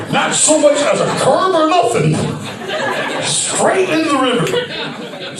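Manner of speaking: high, shouted laugh through the words; cracking up at the memory. Real, not acted. Laughing while describing the riverbank to the host.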